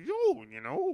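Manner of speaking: high-pitched stoner yo; lightly voiced Shaggy, pitch jumping up. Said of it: "sounding a little bit like, like Shaggy".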